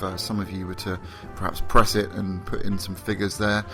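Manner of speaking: ordinary talk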